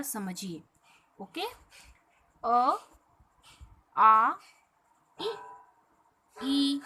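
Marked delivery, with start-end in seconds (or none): 2.4-6.8 अ, आ, इ, ई each read out clearly and apart; vowel recitation. Shown as a demonstration. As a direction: sound each vowel on its own. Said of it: "सभी स्वरों का उच्चारण स्वतंत्र".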